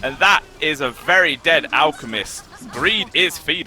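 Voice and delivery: high, loud esports fight call; excited play-by-play. Real, not acted. Caster calling a team fight live, excited play-by-play. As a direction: ride the action up to a triumphant kill call.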